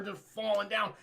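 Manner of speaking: ordinary talk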